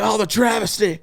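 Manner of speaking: high-pitched, hammed-up ringside outrage; mock wrestling commentator. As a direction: lament like an overdramatic match announcer. Mock wrestling commentary, joking along.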